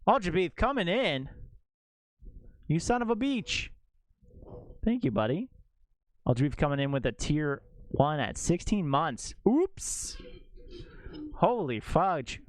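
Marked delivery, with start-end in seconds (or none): 0.1-5.5 high-pitched, delighted stream thank-you; playful high-voiced hype; excited shout-out. Real, not acted. Streamer reacts to incoming subscription. 9.5-12.4 high yelp into drawn-out astonishment; playful streamer astonishment; amazed reaction. Real, not acted. Streamer astonished by sixteen-month sub.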